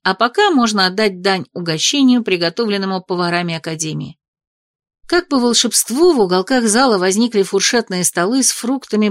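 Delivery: ordinary delivery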